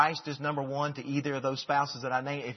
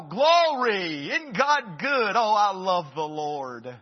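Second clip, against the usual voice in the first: higher and louder, gushing shallow praise; mock fair-weather praise; 4 semitones higher, 6 dB louder, slower than usual. Preacher mocking shallow believers' easy praise, gushing. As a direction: ham up a believer who is only happy when the bills are paid. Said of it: "then we're all".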